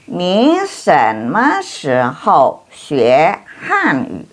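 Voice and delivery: very slow, syllable by syllable, ending on a fall on "Hanyu"; slow demo with every tone carefully placed; slow model reading. Shown as a demonstration. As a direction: lay out "Ni shenme shihou xue Hanyu" slowly for learners. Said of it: "model reading, later repeated faster".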